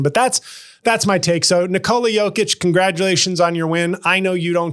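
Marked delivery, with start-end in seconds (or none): none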